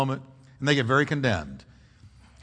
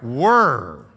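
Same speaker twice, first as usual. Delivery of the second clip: "Were" said alone as one louder word, falling; the word singled out; 4 semitones lower, 5 dB louder than usual. Shown as a demonstration. Pastor naming the prettiest word of the verse, stressed. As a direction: set "Were" apart and lean on it before moving on. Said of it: "What's the prettiest word in that verse?".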